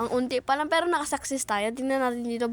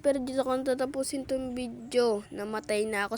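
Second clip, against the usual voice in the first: normal talking voice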